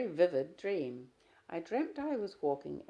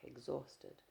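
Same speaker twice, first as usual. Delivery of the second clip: ordinary delivery, nothing marked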